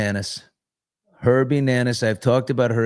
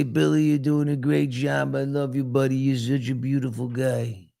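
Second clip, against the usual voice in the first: Brooklyn pal voice, pitched up; Herbie Nanis, Brooklyn accent; 3 semitones higher than usual. Retold or imitated. Imitating a late friend praising his buddy, Brooklyn voice. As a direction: talk like an encouraging Brooklyn buddy. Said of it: "he talked like this".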